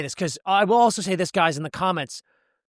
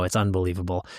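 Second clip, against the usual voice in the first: low catchphrase; 9 semitones lower, 3 dB quieter than usual. Acted. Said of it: "podcast sign-off catchphrase".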